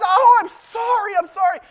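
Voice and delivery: high, loud, panicked "I'm sorry"; frantic apology. Retold or imitated. A boy pleading with the worker he hit, high and panicked. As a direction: blurt it out like a scared kid who just hurt someone.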